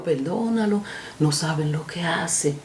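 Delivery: hushed, tender plea; gentle, soft. Retold or imitated. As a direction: forgive them quietly. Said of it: "No, yo vi que le dijo".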